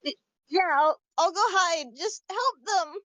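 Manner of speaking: put-on high, hesitant kid voice; little child. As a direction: stammer a little, then plead as a child. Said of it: "she kinda turns to the child".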